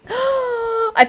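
high, thrilled squeak; real giddy amazement; excited squeal. Real, not acted. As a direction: squeal it after a gasp. Picks up the newborn dragon.

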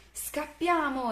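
high, slow cry to flee; drawn-out high storybook alarm; urgent exclamation. A narrator's reading. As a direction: call out to run, stretching the word. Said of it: "esclamò Oscar".

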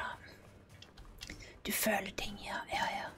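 soft Norwegian whisper. Real, not acted. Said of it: "We're gonna whisper Norwegian".

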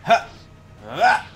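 ordinary talk, nothing marked

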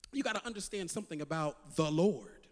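usual speaking voice

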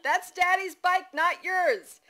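put-on high, loud, drawn-out toddler protest; toddler yell. A narrator's reading. Toddler scolding mother about Daddy's bike, high slow yell. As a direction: yell slowly like an insistent two-year-old. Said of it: "Dawson yelled".

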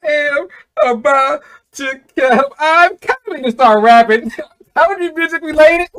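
very high, loud squeal of laughter; cracking up. Real, not acted. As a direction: force words out through helpless laughing. Cracking up mocking the song intro.

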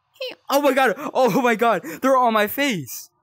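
loud, high panicked cries; panicked yelling. Real, not acted. Player with things crawling on his face, panicked yelling. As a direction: yell in real panic.

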